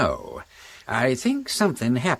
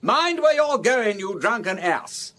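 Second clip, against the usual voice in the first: angry scolding; 9 semitones higher than usual. Acted. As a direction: berate the drunken driver. Acted quarrel with drunk Campbell.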